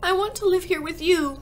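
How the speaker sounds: put-on high, loud child's appeal; earnest, high-pitched little girl; pleading cry. A narrator's reading. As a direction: cry it out, begging. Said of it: "Matilda cried".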